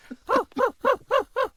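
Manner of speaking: squeaky high cackle; high cackling laugh. Acted. Goose cackle at skit end.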